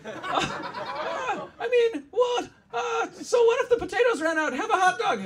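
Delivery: very high, loud mock-Irish comic; exasperated stand-up squeal with an Irish lilt; high-pitched, hammed-up comedian parody; Irish Jerry Seinfeld. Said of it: "I'm, I'm Irish Jerry Seinfeld".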